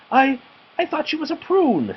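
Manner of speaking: very high, flustered teacher voice; flustered excuse. A narrator's reading. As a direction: caught red-handed, blurt an excuse. Teacher caught, excuses herself.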